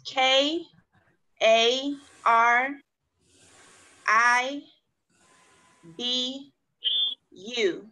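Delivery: each letter said separately; spelling out letters. Shown as a demonstration. Teacher spelling a word for class, letters separated. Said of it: "need me to spell that one out".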